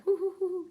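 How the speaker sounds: high monkey hoots; short, high imitated ape calls; monkey chatter. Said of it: "maybe monkeys kind of chatter".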